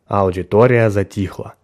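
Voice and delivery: ordinary delivery, nothing marked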